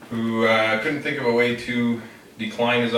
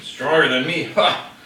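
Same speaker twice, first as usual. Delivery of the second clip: mocking scoff; 6 semitones higher, 5 dB louder than usual. Ogre scoffing at the girl.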